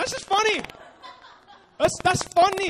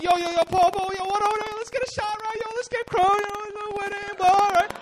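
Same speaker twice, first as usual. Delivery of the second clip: rowdy party friends; 10 semitones higher, 5 dB louder than usual. Retold or imitated. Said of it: "And they're like".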